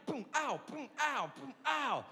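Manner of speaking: jab sound, then high yelp, repeated; mock pained yelps. Comic acting out repeated needle jabs, high mock yelps. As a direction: alternate a punched boom with a high comic ow.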